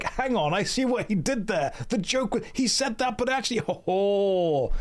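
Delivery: high, excited mock-clever viewer voice; mocking the chat. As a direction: play a chatter who just got the joke. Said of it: "you guys were like".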